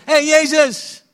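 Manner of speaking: high, loud shouted greeting; calling out. Retold or imitated. Classmates calling the preacher by nickname, high loud call. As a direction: hail him across the schoolyard.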